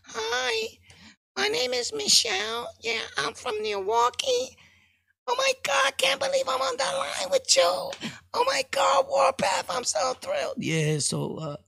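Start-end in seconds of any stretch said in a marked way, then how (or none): 0.0-10.5 very high, breathless fangirl voice; excited female caller. Retold or imitated. Podcast host imitating an excited woman caller, high squeal. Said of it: "taking calls, caller, boom".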